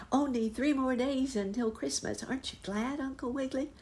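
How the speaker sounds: put-on high, breathy excited child voice; a small girl calling eagerly from the window; little rabbit girl.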